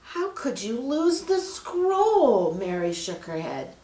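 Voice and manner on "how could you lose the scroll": high, slow exasperated question; can't believe it, scold him; dismayed. Acted. Mary hearing the scroll is gone, dismayed and exasperated.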